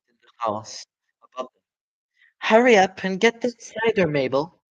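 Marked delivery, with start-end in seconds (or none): 2.4-4.6 high, louder woman calling out. Acted. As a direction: shout down from the house above. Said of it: "the voice called".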